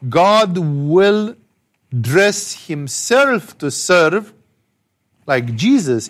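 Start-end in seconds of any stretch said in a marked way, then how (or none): none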